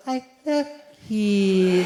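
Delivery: slow [name] coo. Retold or imitated. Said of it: "That voice as well".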